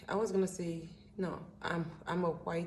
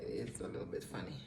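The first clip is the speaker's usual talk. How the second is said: 1 whispered aside; 6 semitones lower, 6 dB quieter, faster than usual. Real, not acted. Aside while looking for lyrics.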